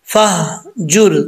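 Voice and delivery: very slow फहजुर, ह clearly stopped with jazm; Quranic recitation. Shown as a demonstration. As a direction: draw out फहजुर with a crisp silent ह. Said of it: "हा के ऊपर जज्म है". (तजवीद के साथ धीमा फहजुर)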